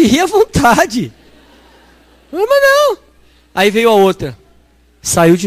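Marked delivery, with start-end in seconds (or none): 2.3-3.0 much higher, insisting; high insistent Neuza. Retold or imitated. Sister voiced high, insisting she will run again. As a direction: brush off the doubt in her voice.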